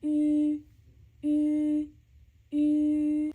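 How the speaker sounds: "ü" said loud, lips tight and round, tongue behind the teeth; loud Mandarin ü. Shown as a demonstration. Teacher repeats "ü" after explaining the tongue position, loud. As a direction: hold the lips tight on each "ü". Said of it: "near the front of your mouth".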